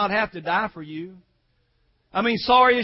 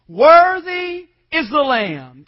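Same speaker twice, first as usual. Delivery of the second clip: loud, slow, stretched-out proclamation; drawn-out pulpit cry; multitude's proclamation; 4 semitones higher, 8 dB louder, much slower than usual. Acted. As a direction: proclaim it like a vast crowd in worship. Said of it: "Watch what they were saying".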